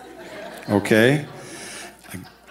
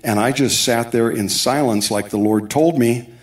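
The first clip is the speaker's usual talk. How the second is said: ordinary delivery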